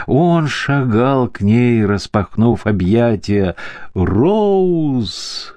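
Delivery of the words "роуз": a high, drawn-out call of "Роуз!". A narrator's reading. Uncle calling to Rose as he strides toward her, a high call. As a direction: call her name out joyfully across the room. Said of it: "вскричал он".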